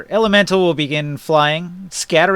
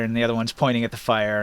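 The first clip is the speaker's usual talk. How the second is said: usual speaking voice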